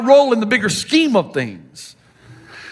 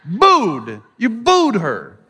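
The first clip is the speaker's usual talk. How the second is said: BOOED drawn out slowly; emphatic correction; much slower than usual. Retold or imitated. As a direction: stretch out BOOED to make the word clear. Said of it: "No, no, no, not boo".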